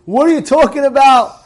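indignant outburst, acted out; retold scream. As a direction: imitate someone loudly objecting. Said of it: "He started to scream".

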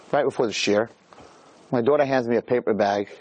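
ordinary talk, nothing marked

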